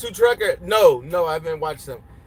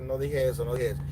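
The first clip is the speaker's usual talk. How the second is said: much softer, low mock-innocent denial; quiet denial; 7 semitones lower, 8 dB quieter, faster than usual. Real, not acted. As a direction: mutter it like you never said it. Quietly denying a joke.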